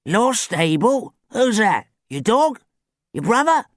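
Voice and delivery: put-on high, loud, character voice of an elderly man; puzzled old man. A narrator's reading. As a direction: query it in confusion. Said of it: "A plump, elderly man".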